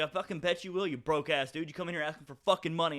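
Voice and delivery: fast-building indignant rant; heated comeback. Retold or imitated. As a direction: tear into him as he squares up. Heated comeback in retold quarrel.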